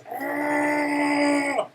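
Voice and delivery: high, loud mock-angry roar; angry growl. Shown as a demonstration. Teacher showing pupils what angry is, mock-angry roar. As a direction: roar in pretend fury. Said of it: "angry is".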